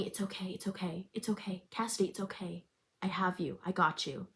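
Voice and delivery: soft, hushed reassurance; gently calm her down; soothing. Acted. Finn soothes crying girlfriend.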